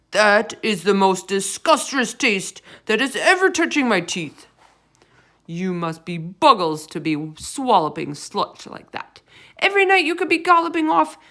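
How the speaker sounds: loud giant ranting in disgust; a greedy giant mocking the veg; blood bottler voice. A narrator's reading. Bloodbottler mocking the BFG's diet, in a loud ranting giant voice.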